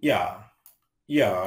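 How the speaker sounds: ইয়া as "ee-ya", set apart for learners; pronunciation demo. Shown as a demonstration. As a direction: model ইয়া clearly, "ee" gliding into "ya". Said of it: "এর উচ্চারণ".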